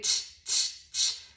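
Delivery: unvoiced ch sound; whispered ch drill. Shown as a demonstration. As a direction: hiss out ch alone. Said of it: "teacher isolating sound".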